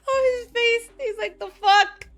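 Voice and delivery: high, loud, laughing squeal; laughing hard. Real, not acted. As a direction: squeal it through real laughter. Cracking up at character's face.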